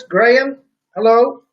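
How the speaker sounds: normal talking voice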